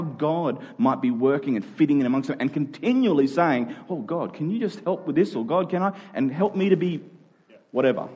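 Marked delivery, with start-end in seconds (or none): none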